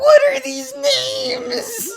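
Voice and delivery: high, slow, laughing protest; laughing disbelief. Real, not acted. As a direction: squeal it out through laughter. Laughing disbelief at show names.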